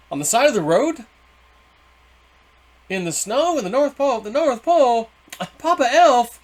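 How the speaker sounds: high, climbing comic disbelief; incredulous. Retold or imitated. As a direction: build from baffled question to loud, high absurd exclamation. Amazed at campfire in snow.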